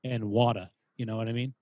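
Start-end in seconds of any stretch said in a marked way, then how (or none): 0.2-0.7 "water" with the final r dropped; mother's regional accent. Retold or imitated. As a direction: say "water" as mom does, ending in "ah". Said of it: "My mom says".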